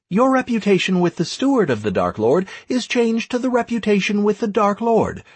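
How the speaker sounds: brisk high-pitched proclamation; game system announcement. Acted. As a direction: rattle off the notification on a high, level pitch.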